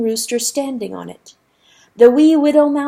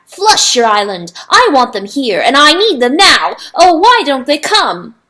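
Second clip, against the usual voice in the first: loud, rising furious demand; angry outburst; 5 semitones higher, 9 dB louder than usual. Acted. Rooster raging at the river, loud and furious. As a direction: demand them now, in a rage.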